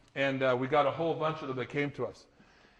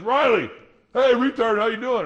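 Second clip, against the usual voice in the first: higher and louder, a jeering schoolyard call-out; bully jeering at Riley, loud mocking taunt; 6 semitones higher, 6 dB louder than usual. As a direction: call it out to mock him, loud and high.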